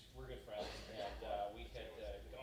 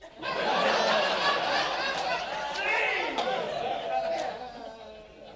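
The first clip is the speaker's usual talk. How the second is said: loud real laughter; 19 dB louder than usual. Real, not acted. Laughing at the punchline.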